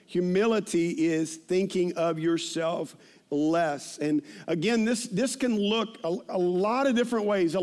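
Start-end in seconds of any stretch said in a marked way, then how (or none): 0.1-4.0 "Humility is thinking of yourself less" drawn out slowly; slow emphasis. Shown as a demonstration. Preacher repeating a definition, "Humility is thinking of yourself less" slowly emphasised.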